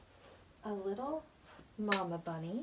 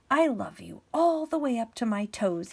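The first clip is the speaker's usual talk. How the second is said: lightly voiced little hare, louder; 3 semitones higher, 8 dB louder than usual. Little hare upside down to big hare, light eager voice. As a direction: lift it a little for the small hare. Little hare's line in story.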